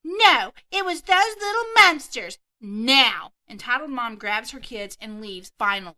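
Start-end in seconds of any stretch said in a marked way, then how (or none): 0.0-2.4 much higher, louder mock Karen shriek; hammed-up furious mom; entitled Karen yelling. Entitled mom yelling at the kids who hurt her son, in a much higher, louder voice. 2.6-3.3 louder, clipped command; mock stern employee; firm order. Club employee ordering the mom out, louder and firm.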